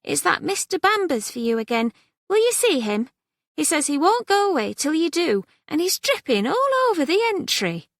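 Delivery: lively maid calling her mistress; maid's voice. A narrator's reading. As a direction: pitch it up as the housemaid. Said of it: "maid announcing a caller".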